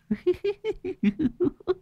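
high, thin possessed cackle; eerie cackle. Acted. Possessed Lan cackling in the dark, eerie laugh. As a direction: let out a ghostly laugh. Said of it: "rồi cười sằng sặc".

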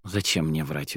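usual speaking voice

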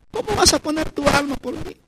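high, hushed questioning; soft reproach. Acted. The Lord questions the prayerless believer, high and softly reproachful. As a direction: drop to a soft, incredulous question.

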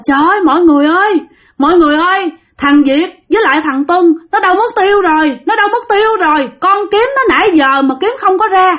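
storyteller's acted cry of alarm; crying out for help. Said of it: "mới la làng lên".

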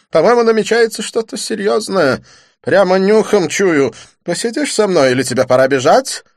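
high, loud, excited chatter; a high put-on voice, eager and urgent; Didier's voice. A narrator's reading. Didier asks his friend to stay, excited.

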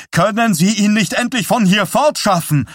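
very high, loud, frantic demand; almost hysterical scream. A narrator's reading. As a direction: shriek it as a distraught mother. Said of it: "schrie Pattys Mutter beinahe hysterisch". (beinahe hysterisch geschrien)

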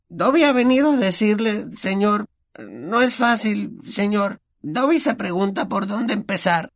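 very high, halting house-elf struggling to begin; an anxious servant unsure how to start; very high elf voice, hesitant. A narrator's reading.

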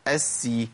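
أسي held s then thin i; doubled sin with kasra. Shown as a demonstration. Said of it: "التشديد بالكسر".

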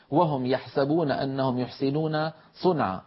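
normal talking voice

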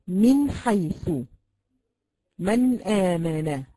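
من حيث said slowly with a clear unheld نون; slow Quran demo, نون pronounced cleanly; clear izhar demo. Shown as a demonstration. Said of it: "تنطقي". (نطق النون واضحة دون زمن)

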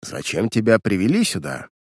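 ordinary delivery, nothing marked

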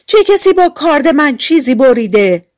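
high, loud indignant dwarf shout. A narrator's reading. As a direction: yell it out, outraged about the knife. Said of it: "ششمی فریاد زد". (فریاد خشمگین کوتوله)

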